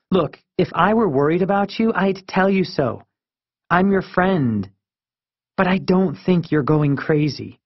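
exasperated friend, high-pitched; Marisol. Acted.

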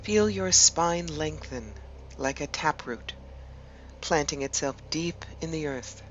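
measured meditation voice with long pauses between phrases; guided meditation. Acted. Host guiding listeners to relax and imagine an ancient wood, guided meditation. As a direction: lead them gently inward, leaving space after each phrase.

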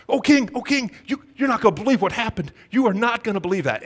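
high, eager, breathless tattling to the king. Retold or imitated. Courtiers eagerly tattling to the king. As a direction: rush it out like eager snitches. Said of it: "you can just see their eagerness".

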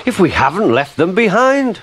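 high-pitched dismayed realisation; astonished. A narrator's reading. Thomas discovering the coaches left behind, astonished. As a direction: let the voice climb in surprise.

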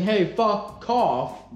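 very slow "Hey, fuck off", the words pulled apart; disguised swearing. Shown as a demonstration. Showing viewers how to avoid demonetization, disguised swearing. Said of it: "a way to avoid demonetization by saying".

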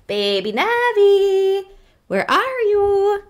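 lightly voiced seeker's call; calling sing-song. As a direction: draw out the call like seeking a child. Calling out in hide and seek.

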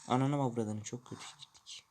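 hushed swearing under breath; whispered curse. Real, not acted. Muttered curse at bad driving. (fısıltıyla küfür)

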